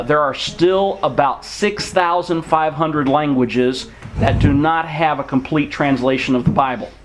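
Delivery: ordinary delivery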